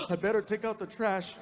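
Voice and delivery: mock startled gasp, then a quick resolve; imitating a spooked kid making an excuse; freaked out. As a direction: gasp as if caught off guard. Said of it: "and he freaked out".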